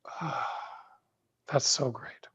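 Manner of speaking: dreamy sigh. Shown as a demonstration. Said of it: "sound like a sigh … you go".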